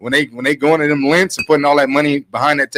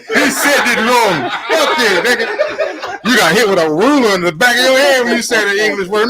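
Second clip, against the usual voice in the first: laughing; 10 semitones higher, 4 dB louder than usual. Real, not acted. Mocking friend's mispronunciation.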